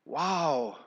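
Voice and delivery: soft, low acted "wow"; awed realization. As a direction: breathe out mild wonder, playing someone who just understood. Acts out a sudden realization.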